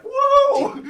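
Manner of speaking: very high, loud whoop; imitated falling yell. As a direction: yell it like someone flying off a stage. Imitating the man tripping, flying.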